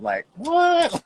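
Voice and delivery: high squeaky disbelieving what; can't believe the sash; baffled what. Retold or imitated. Winner staring at cheap sash, baffled squeak.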